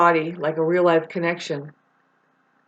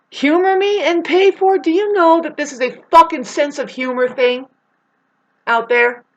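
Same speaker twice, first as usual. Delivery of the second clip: exasperated rant; 8 semitones higher, 5 dB louder than usual. Real, not acted. Frustrated rant peaks.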